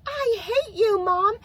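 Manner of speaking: high, mock shriek of an angry little girl; imitating a screaming child. Mom imitating her daughter screaming from her room. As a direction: yell it like a furious four-year-old. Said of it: "if she was in her room screaming".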